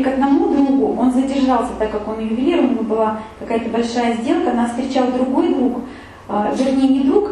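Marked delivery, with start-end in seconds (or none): none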